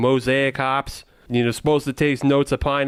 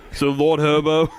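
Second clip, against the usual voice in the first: put-on thick Boston accent. Cohost teasing him, keeps a thick Boston accent. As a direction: lean into a mock Boston accent. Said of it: "now you're not giving up the Boston accent".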